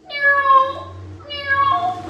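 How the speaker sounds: high, loud cat meow. Pretend. Kids meowing like a cat.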